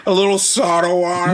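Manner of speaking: mock Philly twang on soda water; Philly accent. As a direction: say it like a South Philly local. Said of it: "Fucking Philly now?".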